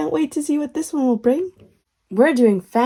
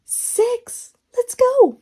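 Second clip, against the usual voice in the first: excited; 13 semitones higher, 4 dB louder, much slower than usual. Acted. Excited announcing number.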